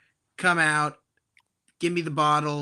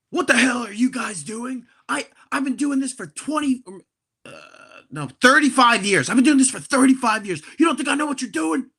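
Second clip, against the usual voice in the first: higher, louder indignant scolding; angry attendant; 7 semitones higher, 6 dB louder than usual. Retold or imitated. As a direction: scold the guys like a proud veteran of the job. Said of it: "he starts being like".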